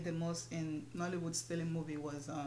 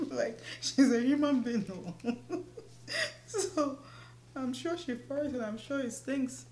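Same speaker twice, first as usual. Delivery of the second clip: laughing through the words, a bit louder and higher; 7 semitones higher, 5 dB louder than usual. Real, not acted. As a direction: giggle through every line. Laughing at her friend's question.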